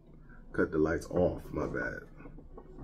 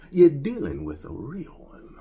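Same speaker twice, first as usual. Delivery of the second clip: higher, showy 'You're dealing with a real one'; cocky brag; 8 semitones higher than usual. Shown as a demonstration. Demonstrating for viewers how to tell the wife, cocky brag. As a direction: say it with swagger. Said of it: "you gotta say it like that".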